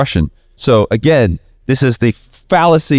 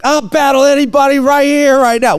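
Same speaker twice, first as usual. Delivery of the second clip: yelling challenge; 13 semitones higher than usual. Retold or imitated. Partner yells a battle challenge at the hostile crowd. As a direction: call out the whole crowd, daring them. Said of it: "yelling at the crowd".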